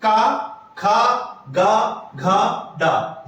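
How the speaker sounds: का खा गा घा डा drawn out slowly from the throat; teacher slowly models का खा गा घा डा; slow throat sounds. Shown as a demonstration. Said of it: "कंठ शब्द से कौन से शब्द बोले जाते हैं".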